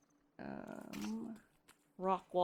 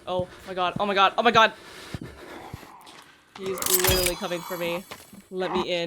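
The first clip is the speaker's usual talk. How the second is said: loud, fast panicked pleading; scared horror game streamer; 6 dB louder, faster than usual. Real, not acted. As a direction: beg to be let in, panicking. Monster chasing her outside.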